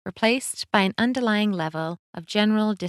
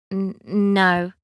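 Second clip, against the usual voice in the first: flustered stammer; 3 dB louder than usual. Acted. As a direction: trip over the no. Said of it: "Wendy stammered".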